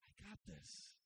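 God's line as a calm whisper. Retold or imitated. God reassuring the worried believer, whispered. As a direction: breathe it, calm and sure. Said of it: "And God's like".

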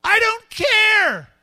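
high, loud brush-off; loud, high-pitched dismissal; dismissive shout. Acted. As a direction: shout them away, drawing the words out. Dismissing someone in an acted quote.

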